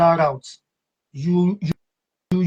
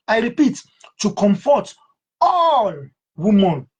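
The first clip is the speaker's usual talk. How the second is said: "all" soars far higher and louder; emphatic repeat; 5 semitones higher, 4 dB louder than usual. Shown as a demonstration. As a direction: cry out "all" with full force.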